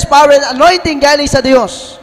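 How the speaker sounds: ordinary talk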